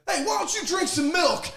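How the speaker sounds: high-pitched imitated heckle; coach's yell. Player imitating Vittorio heckling the little assistant, high yelled taunt. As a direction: holler the taunt across to the other dugout. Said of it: "and Vittorio yells at him".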